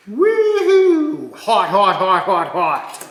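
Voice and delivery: high, loud laughing yelps; yelping hot hot. Real, not acted. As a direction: yelp while juggling something scalding. Grabbing freshly cooked hot bearings.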